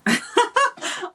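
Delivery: high burst of giggles; giggling laugh. Real, not acted. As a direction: break into a giggle. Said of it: "really bad at reading these without laughing".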